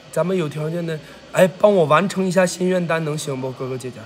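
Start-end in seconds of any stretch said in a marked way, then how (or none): none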